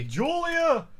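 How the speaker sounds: sharp high shout of the name; calling out. Real, not acted. Co-host shouting the name at the typist, loud and high.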